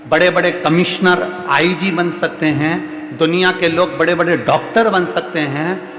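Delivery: loud, rallying exhortation; forceful motivational preaching. Acted. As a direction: preach it loud to rouse the crowd.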